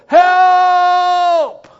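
long, high, full-force yell; high shouted prayer demonstration; impassioned cry for help. Shown as a demonstration. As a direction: cry out desperately to God, drawing it out. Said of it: "With all the emotion and all the passion".

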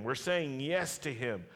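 normal talking voice

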